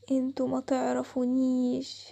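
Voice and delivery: low, slow, eerie ghostly warning. Acted. Unseen ghost calling the girls at night, low and slow. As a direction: draw every word out like a spirit in the dark.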